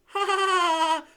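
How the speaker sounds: high, loud gleeful cackle; mocking cackle. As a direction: cackle in triumph. Peeves jeering laugh.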